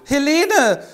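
high, loud cry of her name; alarmed call. Acted. Loth calling out as Helene faints, alarmed. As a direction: call out as she collapses.

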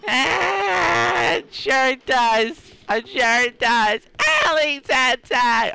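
high, slow, cracking character voice; Jack Bones. Acted. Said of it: "Jack's voice does crack a lot".

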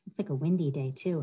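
usual speaking voice

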